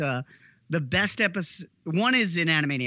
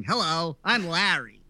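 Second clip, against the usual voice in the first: Larry impression; slower than usual. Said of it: "he's just going".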